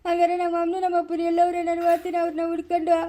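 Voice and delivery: hammed-up crying. Son complains to mother about father, hammed-up crying. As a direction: bawl it like an aggrieved child. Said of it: "ಸುಮ್ನೆ ಇರು, ಅಳ್ಬೇಡ".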